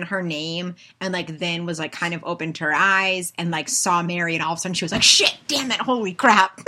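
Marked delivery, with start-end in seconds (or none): 5.0-6.6 higher, louder startled cursing; a startled curse, higher and louder; mock panic. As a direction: caught off guard. Lola waking, panicked.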